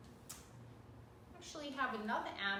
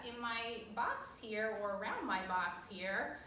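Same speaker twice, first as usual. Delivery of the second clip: usual speaking voice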